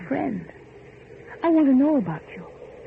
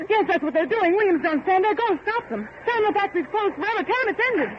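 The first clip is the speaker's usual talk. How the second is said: high, urgent commands; high frantic urging to stop the workers; 9 semitones higher than usual. Acted. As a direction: push him to go, frantic. Frantic orders to stop crowd.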